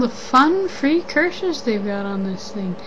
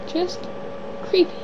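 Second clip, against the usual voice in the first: creeped out; 7 semitones higher than usual. Real, not acted. Reacting again to the creepy emoji.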